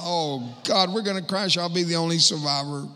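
mock panic. Helicopter spinning, fearing a crash.

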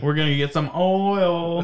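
slow mock Southern drawl; hammed-up Southern twang; Southern accent. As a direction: draw it out like a Southern oilman. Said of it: "I don't know why they got Southern".

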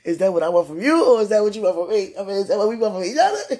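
loud, laughing rhetorical questions, speeding up. Real, not acted. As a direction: tease him and crack up mid-sentence. Teasing partner, bursting into laughter.

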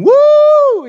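very high, loud celebratory woo; loud whoop. Retold or imitated. Dad cheering at a stranger's birthday party, loud whoop. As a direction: let out a big cheering whoop. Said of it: "and I started whooping".

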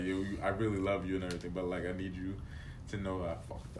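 quiet, quick confessing; soft confession. Retold or imitated. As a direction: murmur the confession fast. Acted confession quote.

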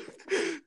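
short high giggle. Real, not acted. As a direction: giggle at the scare. Still laughing at the fright.

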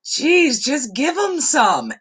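high, loud, fed-up mock grandma; grandma snapping down the phone; exasperated. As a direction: blurt it like a cranky old lady. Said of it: "She goes".

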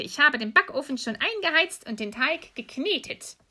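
high, lilting witch voice; scheming old witch. Acted. Old witch planning to bake, high scheming voice. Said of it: "sagte die Alte".

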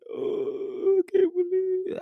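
very high, wailing fake cry; hammed-up tearful wail; mock weeping. Mocking the weepy husband she wanted, fake high wail. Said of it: "tears rolling down my face".

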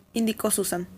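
usual speaking voice

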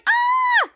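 very high-pitched held "ah" scream. A narrator's reading. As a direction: let out a long, piercing cartoon shriek. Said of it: "Piper was screaming".

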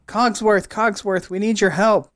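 high-pitched, urgent call for help; Chip yelling up. Acted. Chip calls up to the clock for help, high-pitched yell. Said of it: "Chip yells up".

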